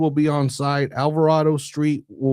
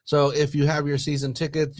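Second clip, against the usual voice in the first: ordinary talk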